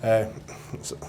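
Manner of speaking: normal talking voice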